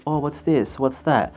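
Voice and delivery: ordinary talk, nothing marked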